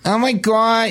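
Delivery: nasal-free mock Jersey "Oh my God", pitched high; New Jersey accent. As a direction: toss off a quick mock New Jersey gasp. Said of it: "if you talk like you're from New Jersey".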